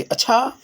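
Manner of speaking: अच्छा pitched high with a questioning rise; अच्छा said emphatically, rising like a question mark; questioning stress. Shown as a demonstration. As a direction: ask अच्छा as a question, voice jumping up sharply. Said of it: "जोरदार देंगे".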